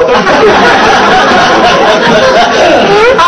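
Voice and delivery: long, high-pitched burst of laughter; laughing hard. Real, not acted. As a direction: crack up at your own joke. Cracks up at his own impression.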